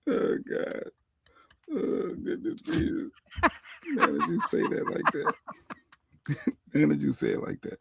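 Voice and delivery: high breathy laugh through the words; embarrassed laughing. Real, not acted. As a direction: laugh it off, caught out by the joke. Embarrassed after gift teased.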